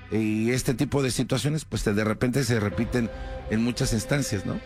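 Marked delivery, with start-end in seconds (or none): none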